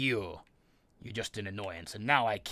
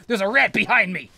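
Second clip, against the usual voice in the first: higher, louder panicked repeat; louder panicked shout; 9 semitones higher, 8 dB louder than usual. Real, not acted. Gamer repeating his panic about the rat, higher and louder. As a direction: cry it out again even higher.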